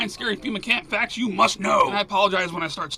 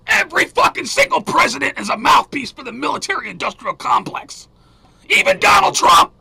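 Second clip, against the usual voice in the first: high, loud furious rant; angry shout; 5 semitones higher, 8 dB louder than usual. Real, not acted. Host yelling back at a woman who criticized him, angry shout. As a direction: tear into the critic, hammering every word.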